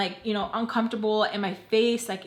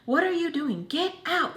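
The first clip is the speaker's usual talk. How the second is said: high, sharp mock yell of get out; imitated yell through the bathroom door; mock angry yelling; 7 semitones higher than usual. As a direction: bark get out like an annoyed aunt. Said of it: "pounding on the door, like".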